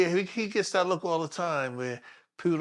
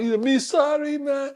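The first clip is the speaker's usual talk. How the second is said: high, pleading Jamaican imitation; Jamaican accent; 10 semitones higher, 4 dB louder than usual. Rider apologizing to the officer, high mock Jamaican accent. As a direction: put on a sorry Jamaican rider, voice way up. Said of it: "he's a Jamaican guy".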